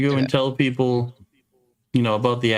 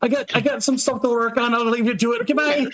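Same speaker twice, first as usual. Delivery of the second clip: high, nervous excuse-making; flustered dwarf; 10 semitones higher than usual. Acted. Dwarf backing out of an awkward talk, high-pitched and flustered. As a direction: squeak out a hurried exit in character.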